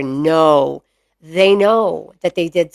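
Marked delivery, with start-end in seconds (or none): 0.0-0.8 lower, firm no; dropped voice. Shown as a demonstration. Owner telling her mastiff off, low stern voice. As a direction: drop the pitch for a stern no to the dog. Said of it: "I drop my voice and I go".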